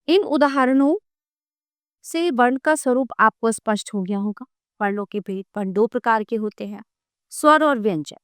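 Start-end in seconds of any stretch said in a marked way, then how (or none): none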